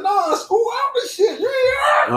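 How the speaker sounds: high, laughing, half-shouted excuse; laughing fib. Retold or imitated. Ten-year-old lying to his mom about school, laughing high squeal. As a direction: squeal out the lie through laughter.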